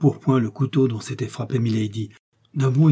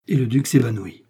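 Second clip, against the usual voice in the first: plain reading voice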